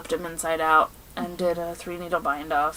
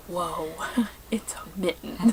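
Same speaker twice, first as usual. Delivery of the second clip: whispered through a laugh; 2 semitones lower, 9 dB quieter than usual. Retold or imitated. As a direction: laugh while quoting him softly. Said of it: "my husband's like".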